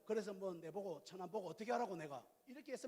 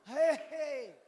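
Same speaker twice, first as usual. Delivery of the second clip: louder, higher mock "에헤이"; hammed-up amazement; 7 semitones higher, 9 dB louder, much slower than usual. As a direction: overplay being impressed. Exaggerated listening reaction.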